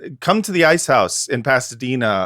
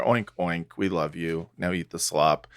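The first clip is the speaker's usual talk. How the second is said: mock tender oinks; 6 semitones lower, 9 dB quieter than usual. Mock-sweet address to piggies.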